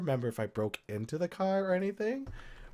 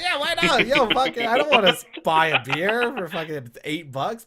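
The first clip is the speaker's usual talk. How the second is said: put-on excited drunk yes; 6 semitones higher, 7 dB louder than usual. Retelling drunk yes to absinthe.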